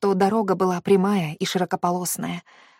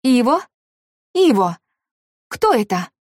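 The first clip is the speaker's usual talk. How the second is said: alarmed calls; 4 semitones higher, 6 dB louder than usual. Acted. Frightened caller hearing sobbing.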